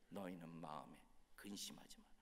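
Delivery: very soft, low, near-whispered verse; hushed echo. Acted. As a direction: let it sink in, low and hushed. Same verse echoed softly. (낮고 조용히 속삭이듯 되뇌는 말씀)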